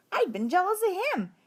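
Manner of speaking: ordinary delivery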